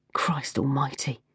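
whispered, slow exasperated curse; man grumbling at a tabloid in a shop; muttered curse. A narrator's reading. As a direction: breathe it under your breath in disgust. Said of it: "he muttered".

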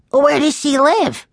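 put-on high-pitched child's question; curious young boy. A narrator's reading. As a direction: ask like an eager little boy. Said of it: "Benny asked".

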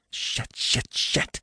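breathless whispered curses; whispered swearing. Acted. Stranded driver swearing in panic.

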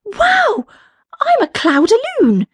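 high, delighted balloon voice; thrilled with the surprise; amazed. Acted. Balloon amazed at new self.

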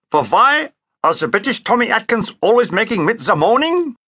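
mock Hitler with German accent. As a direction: rant like a comic Hitler, v for w.

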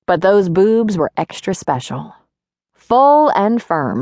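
ordinary delivery, nothing marked

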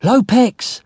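high-pitched urgent yell; shout. A narrator's reading. Narrator tries to wake the sleeping captain, shouting. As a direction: yell the name high to rouse him. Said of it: "I shouted".

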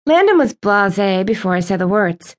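usual speaking voice

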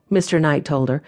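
usual speaking voice

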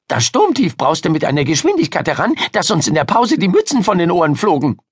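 put-on high, eager girl's chatter; excited storyteller girl. Miriam telling Ida about the storm, excited. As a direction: relish the dramatic news in a high voice.

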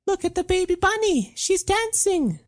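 put-on a small girl cooing at a bunny; little girl. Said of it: "a girl said".

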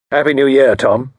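dutiful, offhand mild grunt; perfunctory grunt. Acted. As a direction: get the greeting over with. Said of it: "grunted Evesham perfunctorily".

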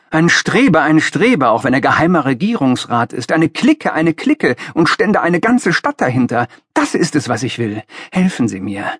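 high-pitched, impassioned idealist's tirade; fervent enthusiasm. A narrator's reading. Young idealist pleads with the master, fervently enthusiastic. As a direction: get carried away with your own zeal. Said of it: "Ich hatte mich in Begeisterung gesprochen".

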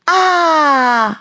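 high, loud, long aah; big aah at fireworks; amazed cry. A narrator's reading. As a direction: cry out in delighted awe. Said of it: "rief Annabella".

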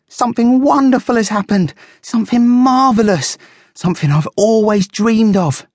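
high, loud, thrilled guinea pig; gleeful high character voice; excited squeaking. A narrator's reading. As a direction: squeak it out, bursting with news. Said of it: "Fuzzy squeaked, dancing a little jig".

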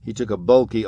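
ordinary delivery, nothing marked

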